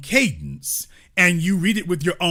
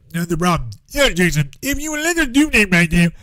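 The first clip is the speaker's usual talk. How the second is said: imitating the rival host; 2 semitones higher, 3 dB louder than usual. Host parodying the North Side host talking to Jason, louder and put-on. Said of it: "the North Side teller up there".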